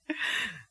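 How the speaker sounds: a brief amused chuckle; a quick embarrassed laugh; short laugh. Real, not acted. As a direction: laugh at your own fake name. Laughs at fake name.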